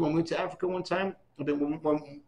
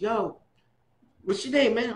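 higher, louder street hail; eager greeting; 7 semitones higher, 4 dB louder than usual. Retold or imitated. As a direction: call out to a stranger you like. Kango greets him, retold.

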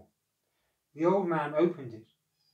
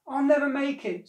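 fearful whine; 11 semitones higher, 8 dB louder than usual. A narrator's reading. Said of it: "said the cat".

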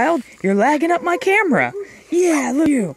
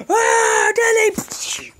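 ordinary talk, nothing marked

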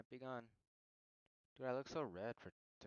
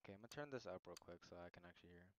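fast aside; 4 semitones lower, 7 dB quieter, much faster than usual. Real, not acted. Quick aside.

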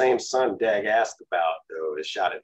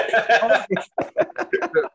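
loud high laugh; hearty laugh; 14 semitones higher, 6 dB louder than usual. Real, not acted. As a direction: burst out laughing. Laughs at joke.

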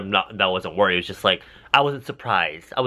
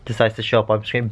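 normal talking voice

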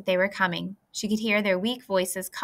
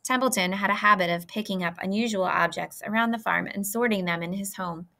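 plain reading voice

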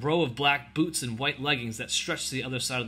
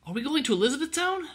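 put-on much higher girlish voice; young girl; 14 semitones higher, faster than usual. A narrator's reading. Ellen to the homesick soldier, high girlish question. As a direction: ask in a high child's voice.

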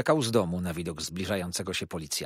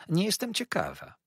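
sulky, higher pouting reply; 12 semitones higher than usual. A narrator's reading. Young wife pouting at her husband, sulky and high. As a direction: pout like a spoiled young wife. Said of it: "nadąsała się Dora".

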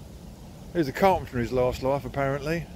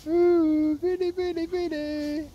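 sing-song call; 12 semitones higher, much slower than usual. Real, not acted. Owner coaxing Vinny back, high sing-song call. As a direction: coo his name up high.

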